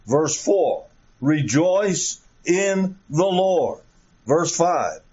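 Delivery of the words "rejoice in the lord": slow emphatic reading. Shown as a demonstration. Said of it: "as I call attention to these expressions".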